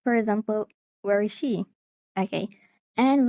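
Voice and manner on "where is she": "Where is she" with pitch dropping on "she"; falling intonation. Shown as a demonstration. Teacher demonstrating a WH question, falling intonation. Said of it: "falling intonation".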